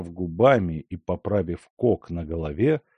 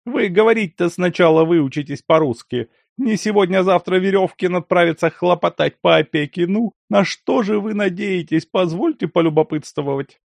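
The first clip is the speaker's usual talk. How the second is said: scornful scolding; 10 semitones higher, 6 dB louder than usual. A narrator's reading. Lyakhovsky scoffs.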